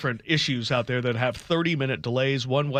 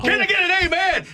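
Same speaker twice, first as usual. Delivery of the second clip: high, loud call for an amen; rally the crowd like a preacher; 4 dB louder than usual. Acted. Hype man cheering on host.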